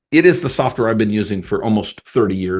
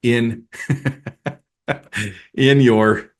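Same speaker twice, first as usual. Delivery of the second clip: breaking into laughter mid-question; real laugh interrupting the question; failing straight face. Real, not acted. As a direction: try to ask it seriously, then crack up. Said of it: "hard to say that with a straight face".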